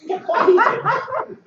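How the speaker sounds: high, loud and slow; getting heated. Shown as a demonstration. Showing the crowd his Wii routine, loud slow build-up. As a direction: wind yourself up, drawn out.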